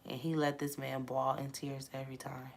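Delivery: ordinary delivery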